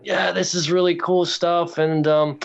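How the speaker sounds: put-on a light, slow English accent for the big reveal; weird English accent. Telling his friends how Clive Barker said his name, in a slow English accent. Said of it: "He says in a really weird English accent".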